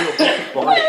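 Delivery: high, loud amazed cry; amazed exclamation. Retold or imitated. Telling friends how he reacted in amazement, laughing. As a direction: blurt it out in wonder, laughing.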